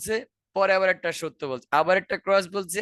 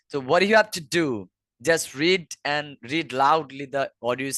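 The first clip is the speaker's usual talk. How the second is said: very slow, deliberate advice; slow emphatic advice; much slower than usual. Shown as a demonstration. Teacher urging students to read aloud: slow. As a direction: drive the advice home slowly. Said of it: "slow emphatic advice".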